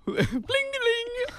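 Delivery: high, laughing bling bling; high ding imitation. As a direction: chime it high like a phone. Imitating phone ding mid-laugh.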